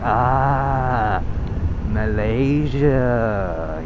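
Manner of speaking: long drawn-out mock realisation; slow, imitated "oh, now I get it"; dawning recognition. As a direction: stretch it right out as if it finally clicks. Retold listeners suddenly recognise the country.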